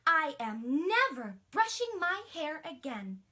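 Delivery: loud, defiant declaration; stubborn refusal. Acted. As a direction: put your foot down like a sulky kid. Princess refuses, stomps off.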